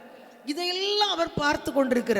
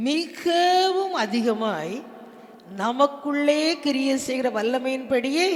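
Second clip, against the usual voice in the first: loud, measured reading with stretched key words; proclaimed scripture reading; 7 dB louder, slower than usual. Acted. Preacher leads congregation in reading Ephesians, loudly proclaimed. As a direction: declaim the verse phrase by phrase. Reads the verse aloud.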